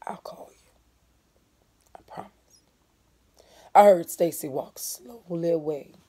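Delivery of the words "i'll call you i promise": soft unvoiced whisper; whispered promise. A narrator's reading. Mama quietly promising Stacy, whispered. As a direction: hush it so no one wakes.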